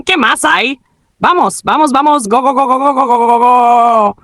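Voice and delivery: high, rapid-fire chant of urging; excited cheering. Retold or imitated. Gamer urging on the match, excited cheering. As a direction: egg them on, stretching out the last go. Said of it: "Luego siguió animado".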